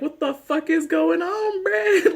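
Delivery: very high, drawn-out mock disbelief; King Ezekiel, shocked. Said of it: "King Ezekiel's like".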